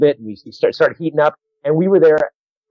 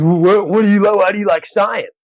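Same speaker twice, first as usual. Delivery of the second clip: higher-pitched heckle, stumbling over the words; imitated heckler; 6 semitones higher than usual. Heckler repeating his question to the comic, imitated heckle. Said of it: "starts heckling".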